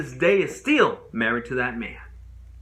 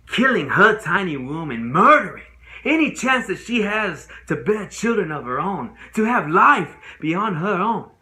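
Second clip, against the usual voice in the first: higher, emotionally swelling courtroom delivery; anguished outpouring; 6 semitones higher, 5 dB louder than usual. Acted. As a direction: build in pained intensity. Anguished climax of assault account.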